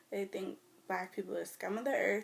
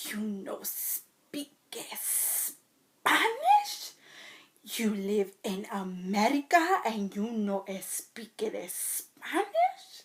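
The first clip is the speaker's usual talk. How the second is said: put-on Spanish accent; 6 dB louder, much slower than usual. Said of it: "they get so mad at you".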